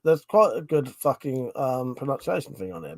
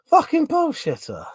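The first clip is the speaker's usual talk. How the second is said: laughing insult; 14 semitones higher, 3 dB louder than usual. Real, not acted.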